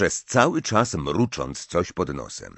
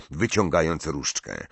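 ordinary delivery, nothing marked

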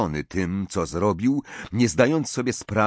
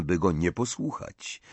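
ordinary delivery, nothing marked

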